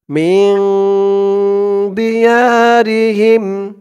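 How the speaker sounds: slow chanted tajweed reading; Quran recitation. Acted. Teacher reciting the verse for students to follow. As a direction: draw out فالذين هاجروا melodically.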